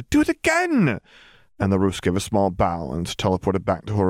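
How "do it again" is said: put-on very high, delighted little-girl demand; excited child calling. A narrator's reading. Hermione applauding the teleporting, high excited call. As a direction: call out eagerly for an encore. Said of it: "clapped and called for her to".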